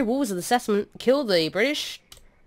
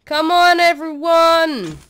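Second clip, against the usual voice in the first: rallying yell; 6 semitones higher, 6 dB louder than usual. Pretend. Leader urging warriors onward in a game, rallying yell.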